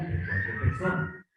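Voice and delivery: usual speaking voice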